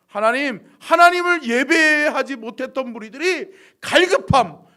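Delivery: loud pleading prayer, building; fervent prayer. Acted. Pastor praying for sleeping souls to return, fervent. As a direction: build into a forceful plea to God.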